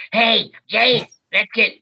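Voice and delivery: high, loud cartoon call-out; booth character call. Shown as a demonstration. Voice actor acting as he does in the booth, loud high character call. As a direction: project a character's shout into the mic. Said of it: "sometimes when I'm in the booth".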